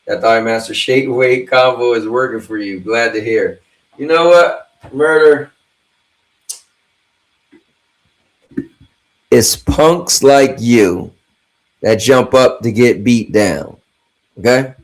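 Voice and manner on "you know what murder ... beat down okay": loud, slow hammed-up tough-guy threat; mock tough guy. Streamer mock-threatening a chatter, loud slow tough-guy voice. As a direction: drawl it out like a movie bruiser.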